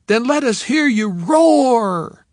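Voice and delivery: high, slow, loud cheer; cheerleader call. Acted. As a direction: stretch it out for the bleachers. Cheerleader calls to crowd.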